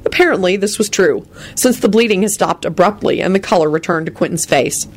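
ordinary delivery

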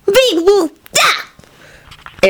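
tiny daughter answering back; small child. A narrator's reading. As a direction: pipe up like a toddler.

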